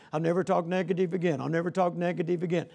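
fast, even chant on repeat; mock rote vow. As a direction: rattle it off like a mechanical self-promise. Mimics rote vows to a mirror.

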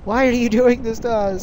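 high, loud wail of protest; shouted plea to the enemy; despairing yell. Real, not acted. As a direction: cry out at the unfairness. Despairs as the team is nuked.

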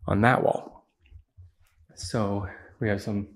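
ordinary talk

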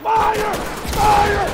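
high loud yell; shouting. Real, not acted. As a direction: yell the order at full force. Panic shooting in raid.

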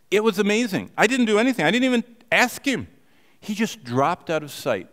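high, loud, thrilled; amazed. Real, not acted. Preacher marvelling at the man's sudden conversion, thrilled and high.

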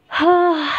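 long, high sigh; real sigh of sadness; sad sigh. Real, not acted. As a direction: heave a heavy, wistful sigh. Sighing over her mother leaving.

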